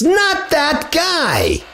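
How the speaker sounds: very high, slow mocking tone; mock dismissal. Preacher mocks the Masonic master, high and slow.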